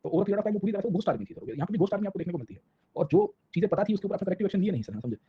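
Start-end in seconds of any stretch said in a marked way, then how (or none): none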